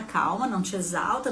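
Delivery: normal talking voice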